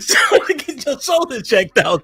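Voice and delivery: high, laughing through the words; breaking up mid-sentence. Real, not acted. Mocking grandma's eye doctor logic, laughing.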